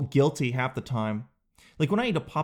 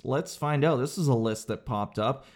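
ordinary talk

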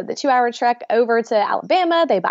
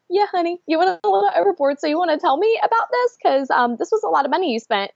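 higher, sugary scolding tone; sweetly pointed; 7 semitones higher than usual. Retold or imitated. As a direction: tease the husband sweetly but firmly. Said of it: "I was like".

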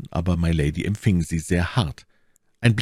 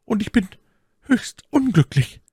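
tearful maid. A narrator's reading. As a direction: voice Cathy close to crying. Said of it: "Cathy vergoß viele Tränen". (hohe, langsame, weinerliche Stimme der Zofe)

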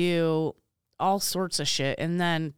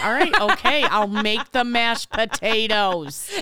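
grudging; 4 semitones higher, 6 dB louder, slower than usual. Retold or imitated. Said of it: "And I'm like".

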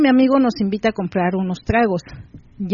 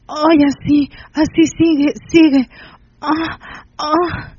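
moaning pleas; 5 semitones higher, slower than usual. A narrator's reading. Olga crying out on the sofa in high moans. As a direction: wail it out, begging him not to stop. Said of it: "y gritaba".

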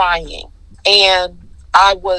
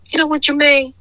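higher knowing quote; 8 semitones higher than usual. Retold or imitated. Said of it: "And she said".

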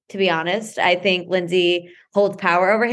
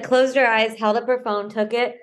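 ordinary delivery

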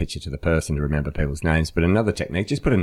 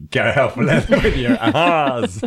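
louder and higher, tipping into laughter; gleeful, laughing encouragement; 7 semitones higher, 4 dB louder than usual. Real, not acted. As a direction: urge them on, cracking up. Joking, breaking into laughter.

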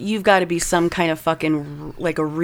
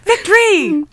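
triumphant cheer; 12 semitones higher, 6 dB louder, slower than usual. Pretend. Cheering the arrest.

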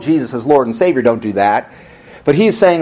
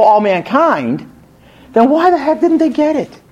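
higher and higher, pressing the point home; building sermon fervor; 8 semitones higher than usual. Acted. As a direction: preach it up to an exasperated peak. Preaching at rising intensity.

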